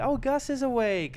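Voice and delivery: high, bright happy parent; cheery family voice. Retold or imitated. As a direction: chirp it like an overjoyed mom. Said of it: "characters where my family was all really happy".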